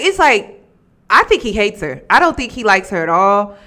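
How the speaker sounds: normal talking voice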